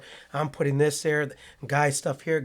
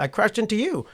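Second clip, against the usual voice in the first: insistent apology; 9 semitones higher than usual. Retold or imitated. Insisting after crashing into him.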